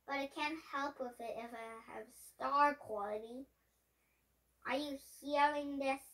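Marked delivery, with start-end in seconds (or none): none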